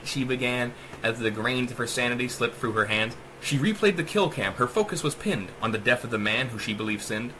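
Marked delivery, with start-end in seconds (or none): none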